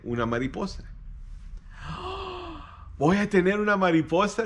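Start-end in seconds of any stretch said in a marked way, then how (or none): 0.9-2.9 a high breathy gasp of wonder; excited child gasp. Acted. Boy hears he'll get a butterfly, delighted gasp. 3.0-4.4 high and louder with joy; thrilled child announcing good news; excited boy. Acted. Boy tells mother he'll have a butterfly, excited.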